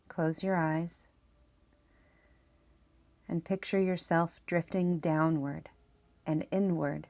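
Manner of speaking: measured meditation voice with long pauses; spoken meditation script, leaving room to breathe; guided meditation. Acted. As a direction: guide the listener inward, phrase by unhurried phrase.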